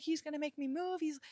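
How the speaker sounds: retold panic. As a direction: retell freaking out, pitch lifted. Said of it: "freaking the fuck out".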